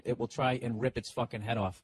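ordinary talk

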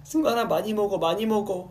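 high, loud fussing; doting fan. Retold or imitated. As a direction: fuss over him like a doting fan. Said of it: "이러면서".

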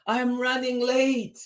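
high-pitched hurried call; bus driver's shout. A narrator's reading. Bus driver running late, high storybook shout. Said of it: "shouted the bus driver".

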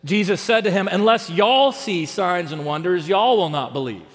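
light Texan accent, saying y'all with a drawn-out vowel; a touch of Texas twang on the verse; Texan drawl. Shown as a demonstration. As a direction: read it like a Texan preacher, leaning into y'all. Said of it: "So a Texan version of the Bible might read this".

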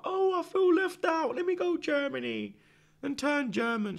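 very high, slow mock whine; mocking Musiala. Fan mocks Musiala for switching to Germany, high whiny mockery. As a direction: put on a whiny voice to ridicule him. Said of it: "You're fake German, blood, because you felt left out".

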